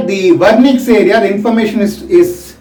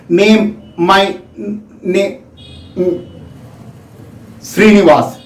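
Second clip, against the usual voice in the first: slow, broken, groping for each word; halting telegraphic speech; slower than usual. Shown as a demonstration. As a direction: stumble through it haltingly like a Broca's patient. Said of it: "he takes his own time".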